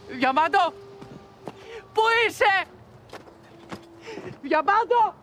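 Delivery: calling out. Acted. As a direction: call her name into the dark. Searching calls out for girl. (φωνάζει ψάχνοντας το κορίτσι)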